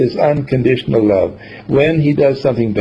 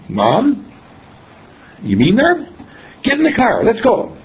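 much higher, eager and rushing; eager son; 8 semitones higher than usual. Retold or imitated. Son thrilled by the promise, voice jumping high. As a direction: jump at the offer. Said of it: "son's eager reply".

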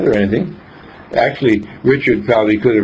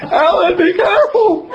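high laughing mock holler; sudden high-pitched warning shout, half laughing. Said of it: "was gonna holler out".

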